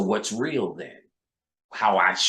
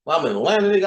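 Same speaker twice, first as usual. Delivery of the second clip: put-on Southern accent; 7 semitones higher, 4 dB louder, much faster than usual. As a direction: slip into a light Atlanta accent. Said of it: "I be finding myself doing the accent".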